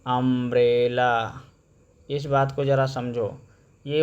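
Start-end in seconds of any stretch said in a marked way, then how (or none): 0.1-1.4 अम्ब्रेला said slowly, um-brella; अम्ब्रेला spoken slowly and clearly; model word. Shown as a demonstration. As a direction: sound out अम्ब्रेला with a short um. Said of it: "देखो ऐसे लिखते हैं".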